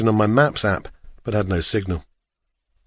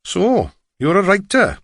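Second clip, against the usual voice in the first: higher, lilting Welsh driver voice; strong Welsh accent; 7 semitones higher than usual. A narrator's reading. Driver questions the lost walker, strong Welsh accent. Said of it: "his strong Welsh accent".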